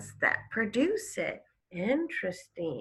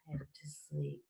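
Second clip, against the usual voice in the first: whispered; 6 semitones lower, 14 dB quieter than usual. A narrator's reading. Reading to class, whispering the man to sleep. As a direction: whisper it softly, drifting off.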